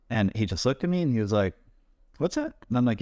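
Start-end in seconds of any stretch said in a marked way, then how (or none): 2.2-2.5 high, incredulous squeak of disbelief; shocked co-star can't believe the request; a retold double take, pitched way up; startled what's that. RDJ stunned by kneeing request.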